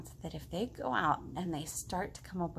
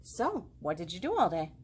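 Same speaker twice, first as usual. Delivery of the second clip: plain reading voice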